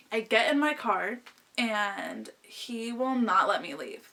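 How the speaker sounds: usual speaking voice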